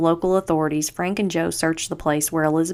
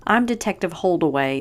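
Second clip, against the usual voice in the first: ordinary delivery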